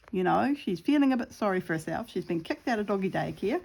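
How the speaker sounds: normal talking voice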